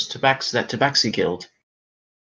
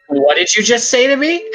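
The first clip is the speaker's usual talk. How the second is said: mock indignant; 5 dB louder than usual. Mock offense at garbled question.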